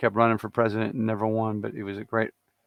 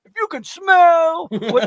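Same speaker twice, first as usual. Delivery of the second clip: wrestling promo shout; 7 dB louder, much slower than usual. Pretend. Repeat of promo.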